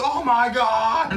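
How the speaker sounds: slow, hammed-up movie yell with a laugh; imitated yelling. Co-host imitating an actor's overblown scene, mock yelling. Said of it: "parts where he's just yelling".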